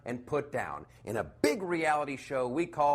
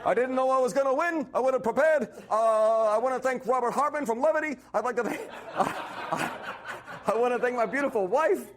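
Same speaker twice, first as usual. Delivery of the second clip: higher, louder, hurried flustered thanks; hammed-up rushed acceptance; mock Bernie acceptance speech; 9 semitones higher, 4 dB louder, faster than usual. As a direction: gush through an award speech. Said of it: "Bernie Sanders for".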